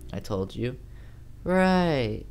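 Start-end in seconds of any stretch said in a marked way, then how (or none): 1.4-2.2 Amy's voice. Acted. Narrator voices Amy on phone.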